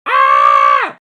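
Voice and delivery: very loud, high-pitched scream; piercing comic scream; sudden scream. Acted. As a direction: shriek out one sustained "Ah!". Sudden scream between topics.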